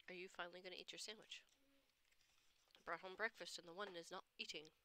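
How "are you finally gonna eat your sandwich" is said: quiet repeat. Real, not acted. Repeated softly.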